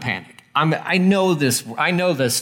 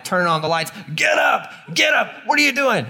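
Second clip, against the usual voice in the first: frantic yelling parent; 7 semitones higher than usual. Retold or imitated. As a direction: yell at the kids to get up for school. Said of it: "You're like".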